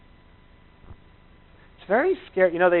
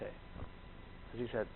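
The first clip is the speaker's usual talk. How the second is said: whispering; 5 semitones lower, 23 dB quieter, much slower than usual. Real, not acted. Unvoiced aside.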